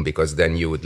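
normal talking voice

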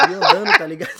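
high squeal of laughter; laughing while telling it. Real, not acted. Laughing at walking to party. (rindo alto e agudo)

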